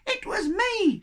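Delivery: "It was me" shouted high and loud; snowball shout. Acted. The echo snowball shouts Shen's words at the yetis, loud and high. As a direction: call "It was me" out high and cheeky. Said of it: "shouted the snowball".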